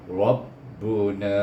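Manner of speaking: Rabbuna with heavy ra; slow Rabbuna, full thick ra; over enunciating. Shown as a demonstration. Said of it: "berbaca dengan ra dengan tebal".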